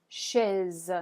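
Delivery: chaise with French sh and open e; French word chaise. Shown as a demonstration. Teacher models chaise. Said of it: "examples of French words".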